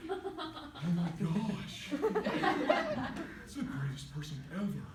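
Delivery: hammed-up awestruck oddball voice; Napoleon Dynamite. Young man seeing her first time, imitated Napoleon Dynamite. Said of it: "went into Napoleon Dynamite mode".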